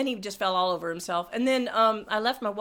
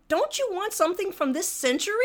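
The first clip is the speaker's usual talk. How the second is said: pointed jab; 8 semitones higher than usual. Retold or imitated. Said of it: "but I said".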